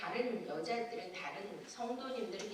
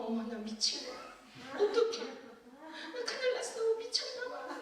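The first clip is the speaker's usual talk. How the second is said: inner panic; 8 semitones higher than usual. Retold or imitated. Thinking she has gone mad, high mock panic. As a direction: panicky self-talk, much higher. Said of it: "제가 속으로".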